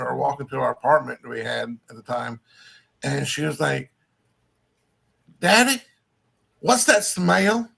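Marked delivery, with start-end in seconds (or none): 5.4-7.7 put-on high, loud Southern child with a drawl; country-accented little girl. Four-year-old daughter asks her dad, country accent. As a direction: stretch it out like a small country girl. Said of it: "Where the hell is that accent coming from".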